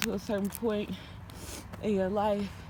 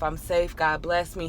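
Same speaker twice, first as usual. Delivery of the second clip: usual speaking voice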